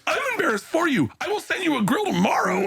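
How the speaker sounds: mock pitying Joe. [name] retells Joe's pity.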